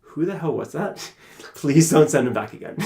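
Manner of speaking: reading the harsh feedback through laughter; chuckling at a brutal review; laughing while quoting. Retold or imitated. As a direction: laugh through the quote of the complaint. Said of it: "they literally wrote".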